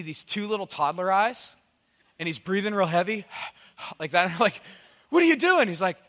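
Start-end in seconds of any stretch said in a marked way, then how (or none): 5.1-5.7 high, loud startled question; alarmed question. Retold or imitated. Startled dad asks toddler by the bed, high alarm. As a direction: blurt it out, pitched up in mock alarm.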